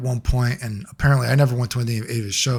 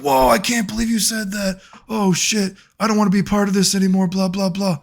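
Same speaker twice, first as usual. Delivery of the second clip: higher, whiny mock outrage; mocking shocked friends; 7 semitones higher than usual. Mocking friends who abandon the speaker, whiny put-on outrage. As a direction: parody the friends backing away in disbelief. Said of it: "And people are like".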